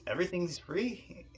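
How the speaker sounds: double-check the good news, pitch climbing; surprised question. Retold or imitated. Acting his disbelief at free food.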